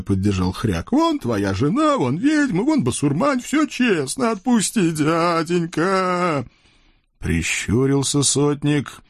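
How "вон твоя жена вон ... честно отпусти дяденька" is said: high, whimpering imp voice with a mock sob; sobbing devil. Devil Khryak whimpers to the Cossack, high and teary.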